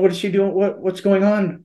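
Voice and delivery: high-pitched, worried questions; anxious questioning. Retold or imitated. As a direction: retell a husband pressing anxiously for answers. Retells his frantic questions about wife.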